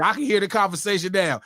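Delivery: ordinary delivery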